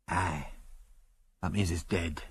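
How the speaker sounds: low, soft Scots voice; subdued old Scotsman's accent. A narrator's reading. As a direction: take bad news quietly in a Scots accent. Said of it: "in a strong Scots accent".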